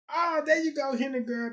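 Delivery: loud happy shout-out; excited cheer. Real, not acted. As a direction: celebrate the gift. Reacting to chat donation.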